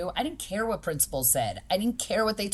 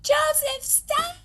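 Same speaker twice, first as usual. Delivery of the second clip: put-on very high, loud little-girl screech; imitated child's scream; 4 dB louder, much slower than usual. Three-year-old screams at Joseph to stop, imitated shriek. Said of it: "So she screamed".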